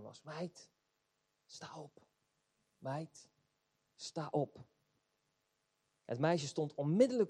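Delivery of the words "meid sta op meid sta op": soft, tender wake-up call, much quieter; a friendly, encouraging tone to a girl; gentle encouraging. Shown as a demonstration. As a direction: coax her up kindly, almost hushed. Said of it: "op een vriendelijke toon ... bemoedigende toon".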